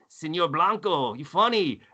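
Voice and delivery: put-on slow, lightly done East LA Chicano accent; a light East LA gangster drawl. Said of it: "you do that East L A accent".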